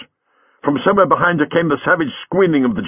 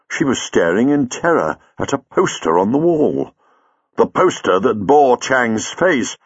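usual speaking voice

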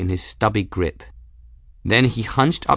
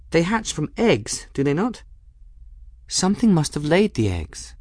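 put-on higher-pitched girl, pressing her point; lightly voiced firm girl; 9 semitones higher than usual. Bridget reasoning with Folly about eggs, firm. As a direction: argue it firmly in a higher voice.

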